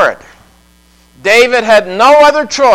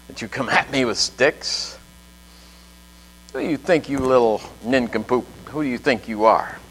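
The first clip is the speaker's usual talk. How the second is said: much lower and softer, a scornful giant; mock Goliath; 8 semitones lower, 11 dB quieter than usual. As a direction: sneer it low like a mocking giant. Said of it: "He said".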